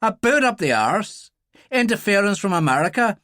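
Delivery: high-pitched, bitter Irish tirade; genuine anger. A narrator's reading. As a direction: rant bitterly, gathering speed at the end. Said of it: "genuine anger in his voice".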